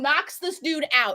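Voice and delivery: normal talking voice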